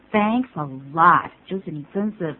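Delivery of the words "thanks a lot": lower, ironic thank-you; thanks that really means the opposite; sarcastic thanks. Shown as a demonstration. Teacher showing thanks for help that backfired, sarcastic. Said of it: "意思就是有点反讽的味道".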